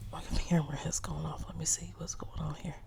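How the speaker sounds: whispered aside about the camera; breathy under-the-breath check; muttering to herself while fixing the camera. Real, not acted.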